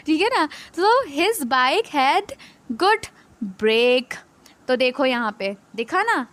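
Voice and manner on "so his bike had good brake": very slow, word by word; slow read-aloud. Shown as a demonstration. Teacher reading the example sentence, very slowly. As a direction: drag out each word for the class.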